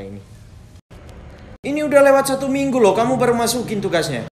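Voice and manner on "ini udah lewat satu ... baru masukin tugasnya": high, fast scolding teacher voice; teacher nagging about a late assignment; stern teacher. Acted.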